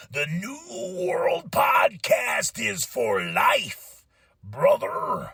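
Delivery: very slow gurgling growl; gurgle voice. Shown as a demonstration. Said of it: "I gotta get my gurgle voice".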